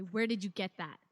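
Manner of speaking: loud accusing question; demanding. Acted. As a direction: demand it loudly. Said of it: "I point to her and I say".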